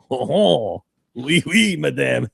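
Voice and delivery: a hint of a hammed-up French accent; mock French accent. Imitating the man who turned French, light mock French. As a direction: play a cartoon Frenchman, lightly. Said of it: "he's turned French now".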